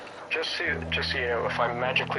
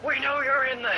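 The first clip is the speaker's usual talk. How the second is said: high, loud cop yell; police shout; 13 semitones higher, 4 dB louder than usual. Pretend. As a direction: bang on the door as police. Playing police at the door.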